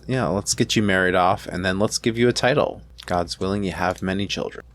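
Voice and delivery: ordinary talk, nothing marked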